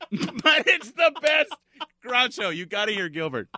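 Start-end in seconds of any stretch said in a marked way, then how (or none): 0.1-2.6 laughing through it, higher, slow; giggling out the words. Real, not acted. Laughing at his impression.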